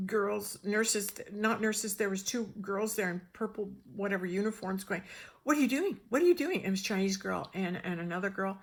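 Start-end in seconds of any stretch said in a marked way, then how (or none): 5.4-6.6 higher, louder alarmed challenge; retold indignant yell; imitated yelling. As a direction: mimic staff yelling after someone leaving. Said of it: "they were yelling at us".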